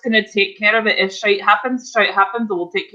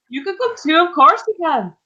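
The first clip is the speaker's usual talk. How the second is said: much higher, sweet welcoming voice; sweet invite; 9 semitones higher than usual. Acted. Sim inviting Fries along, higher and sweet. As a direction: lift the pitch, make it kind. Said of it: "she's like".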